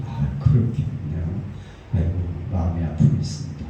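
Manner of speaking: low, unhurried consoling reply; soothing sympathy. Retold or imitated. Pastor hearing out an upset deacon, soothing.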